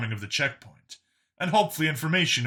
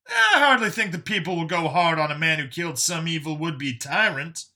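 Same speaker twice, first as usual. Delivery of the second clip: higher, animated character voice; dismissive Brian; 5 semitones higher than usual. A narrator's reading. Brian reassures Vincent he won't be jailed, dismissive. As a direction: wave the worry away in a raised voice.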